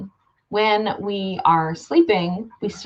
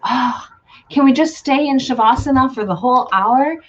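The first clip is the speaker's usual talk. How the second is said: higher-pitched imitation of a wistful yoga student; sigh, then plead lightly in a raised voice; mock pleading; 5 semitones higher, 3 dB louder than usual. Voicing yoga students' wistful request.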